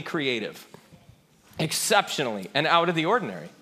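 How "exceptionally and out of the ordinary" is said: ordinary delivery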